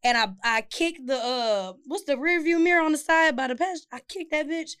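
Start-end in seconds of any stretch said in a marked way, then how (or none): none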